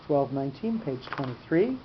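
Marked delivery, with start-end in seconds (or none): none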